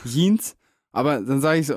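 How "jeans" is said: Jeans as "Yeens", pitched up; Oma's mispronunciation. Retold or imitated. Grandchild imitating Oma for a friend, Jeans mispronounced as "Yeens". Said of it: "dieses englische J scheinbar nicht aussprechen".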